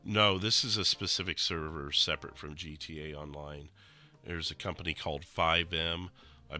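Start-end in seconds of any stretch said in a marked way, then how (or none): none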